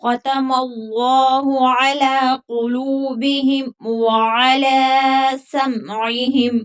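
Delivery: slow melodic tajweed chant; Quran recitation. Shown as a demonstration. Teacher reciting verses melodically for students. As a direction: stretch the vowels in a measured recitation.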